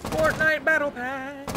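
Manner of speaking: slow, loud hyped announcer; drawn-out ad announcer call; mock announcer.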